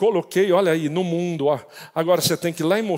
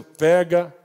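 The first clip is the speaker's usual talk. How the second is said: voice of God; 2 semitones lower than usual. Retold or imitated. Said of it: "de Deus dizendo".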